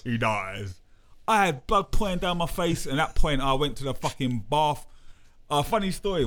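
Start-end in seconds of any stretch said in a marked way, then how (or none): 0.0-0.8 imitating Drago. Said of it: "you know Creed when he goes".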